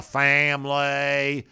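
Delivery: FAMILY drawn out in a put-on accent; mock fake accent. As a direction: imitate a badly faked accent, stretching "family". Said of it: "the issue with the accent".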